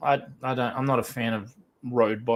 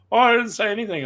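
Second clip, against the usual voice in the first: mock innocent denial; 8 semitones higher than usual. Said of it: "It's like".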